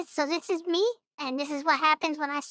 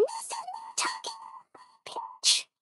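much higher, thin and straining; raised pitch; 12 semitones higher, 9 dB quieter, slower than usual. Shown as a demonstration. Showing viewers a voice changer, pitched way up high. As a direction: push the voice up high until it cracks. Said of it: "then I'm gonna raise my voice's pitch".

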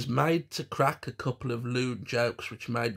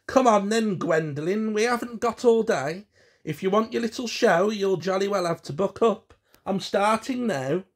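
loud, high-pitched summons; impatient shout; 8 semitones higher, 6 dB louder than usual. A narrator's reading. William hurries Gwendolen to the games room, impatient shout. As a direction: holler for someone to hurry up. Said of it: "summoned from the kitchen by a shout".

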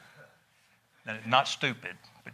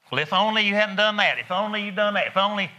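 higher, louder whiny voice; regretful demon Ivan; 7 semitones higher, 4 dB louder than usual. Acted. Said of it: "that's Ivan".

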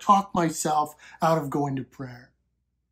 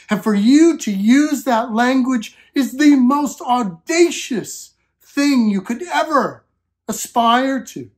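fervent preaching; 4 semitones higher than usual. Acted. Preacher urging the congregation about God as Father, fervent and raised. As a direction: preach it out, building conviction.

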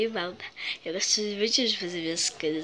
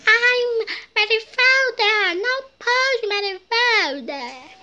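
high shrill scolding mother; nagging mom voice, very high; imitating mom; 11 semitones higher, 10 dB louder, slower than usual. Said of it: "Deixa eu imitar a voz dela todinha".

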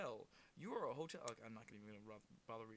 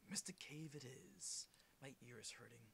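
slow spooky whisper; 3 semitones lower, 13 dB quieter than usual. Pretend. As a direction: breathe it out slowly, voiceless. Hushed after loud talk.

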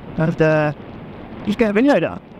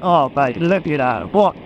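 ordinary delivery

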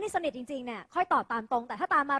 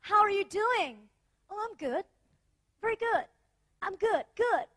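lightly acted American greeting exchange, pitched up; เลียนแบบฝรั่งทักทาย; 5 semitones higher, much slower than usual. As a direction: play both sides of a casual hello, higher than usual. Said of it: "โดยเฉพาะในฝั่งอเมริกานะคะ เขาจะแบบ". (แสดงเป็นคนอเมริกันทักทายกันเบาๆ เสียงสูงขึ้น)

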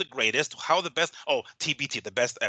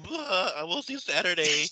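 lightly mimicked fighter, a bit higher; mock fighter comeback; 5 semitones higher, slower than usual. Co-host mimicking the fighter's Saturday boast, light mockery. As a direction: echo the feeble boast mockingly. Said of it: "You know, so be like".

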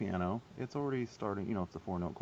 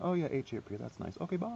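mock oblivious; 6 semitones higher, 3 dB quieter, faster than usual. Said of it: "seeing H A R P and saying".

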